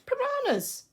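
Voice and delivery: panicky repetition. Acted. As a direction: cry the word out high, in disbelief. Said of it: "She repeats that word again".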